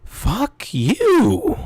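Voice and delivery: mock furious yell laughing through it; triumphant outburst. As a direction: blurt it like an epiphany. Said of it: "like an epiphany".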